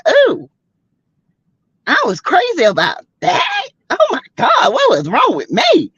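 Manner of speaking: high-pitched, dramatic self-mockery; mock disbelief. Viewer looking back at an ex, mock horrified. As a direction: cringe at your old crush in a high squeal. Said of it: "you be like".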